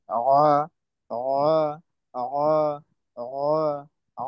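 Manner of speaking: অ squeezed from the middle of the throat; throat-pressed ain. Shown as a demonstration. Teacher demonstrating ain to the class, throat-pressed. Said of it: "উচ্চারণটা করতে হবে গলার মাঝখান থেকে চাপ দিয়ে করতে হবে".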